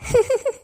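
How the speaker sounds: short bright laugh; a pleased little laugh; amused laugh. Acted. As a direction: laugh at his teasing. Mother laughs at son-in-law's joke.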